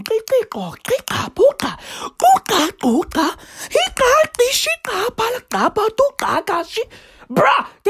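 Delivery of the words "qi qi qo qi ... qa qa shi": high, rapid gibberish of click syllables; mock click language. Mimicking the Khoisan greeting the ship, high mock clicks. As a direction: rattle off nonsense clicks in a high voice. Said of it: "The fucking Khoisan were out there going".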